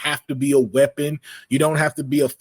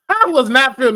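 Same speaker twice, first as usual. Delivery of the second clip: laughing; 11 semitones higher, 6 dB louder, faster than usual. Real, not acted. Laughing at the cheesy scene.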